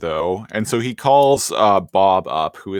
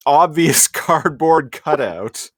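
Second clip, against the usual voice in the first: ordinary talk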